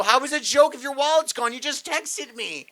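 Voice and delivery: high, exasperated retold protest; mock indignation. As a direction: mimic a partner's baffled complaint. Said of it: "You go".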